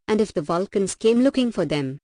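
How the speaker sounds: ordinary delivery, nothing marked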